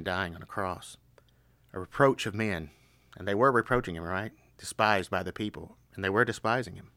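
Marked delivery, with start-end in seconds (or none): none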